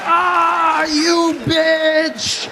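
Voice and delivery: high shouted curse in alarm; panicked curse. Retold or imitated. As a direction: yell at the cars coming your way. Cursing at oncoming cars.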